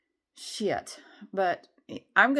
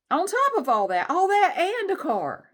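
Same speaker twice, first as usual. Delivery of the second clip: exclaiming; 10 semitones higher, 7 dB louder than usual. Real, not acted. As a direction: crow it out triumphantly. Exclaiming about the car.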